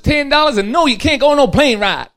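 high, loud nagging wife; wife firmly refusing her husband, high and loud; stern wife voice. Acted.

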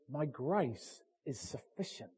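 low, soft and slow; soft slow divine word. Acted. God speaking to the confronted believer, soft and slow. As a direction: murmur it gently. God's voice.

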